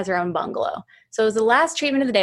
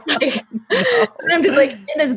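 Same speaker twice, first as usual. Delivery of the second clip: laughing; 6 semitones higher, 4 dB louder, much slower than usual. Real, not acted. Laughing while telling ghost story.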